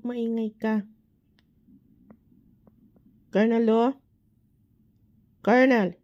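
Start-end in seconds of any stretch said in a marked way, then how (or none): 3.3-5.9 loud call by name; raised voice, calling a name. Real, not acted. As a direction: call out loudly to rouse a sleeper.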